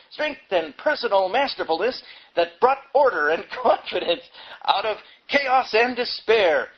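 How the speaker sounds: hammed-up pompous magazine eulogy, half mocking; bombastic reading. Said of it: "Its bombastic style".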